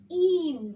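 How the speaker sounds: ईम with the ee drawn long and loud; stretched ee sound. Shown as a demonstration. Teacher stretching ईम for children. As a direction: stretch the ईम vowel for the kids. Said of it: "नीम लिखना है तो N".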